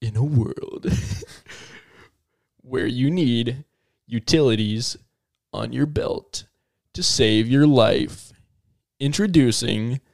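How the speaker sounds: low, slow trailer voice with long pauses; mock-epic low announcer; movie-trailer announcer. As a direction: hush it down like a dramatic film trailer.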